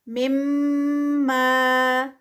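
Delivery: مما with ghunna, then a two-count ما; tajweed recitation. Shown as a demonstration. Teacher models مما for students. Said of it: "الف مد آ گئی ہے".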